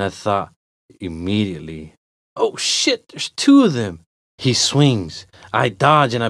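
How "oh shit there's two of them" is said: high-pitched start, then dropping; alarmed realization. Acted. Walker spots a second attacker, alarmed. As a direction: blurt the sudden thought.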